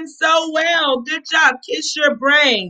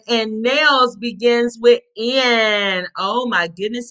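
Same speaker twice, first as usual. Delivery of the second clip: usual speaking voice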